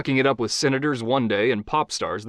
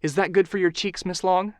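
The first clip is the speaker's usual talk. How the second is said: higher, overly gentle makeup artist voice; too gentle makeup artist; 8 semitones higher than usual. Acted. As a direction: tiptoe around her, higher and gentle. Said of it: "asked a bit too gently".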